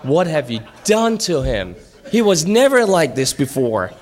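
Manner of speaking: hammed-up exasperated wife; dismayed wife. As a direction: demand an explanation, pitch and volume climbing. Wife's dismayed question acted out.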